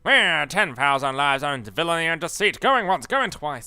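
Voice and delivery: auctioneer voice. Retold or imitated. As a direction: sell it like a grand auctioneer. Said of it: "put on a bit of a voice".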